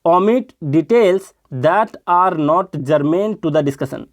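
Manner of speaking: each word stretched out very slowly; very slow reading. Shown as a demonstration. Teacher reads the example sentence very slowly for students. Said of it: "example के लिए बोल रहा हूँ".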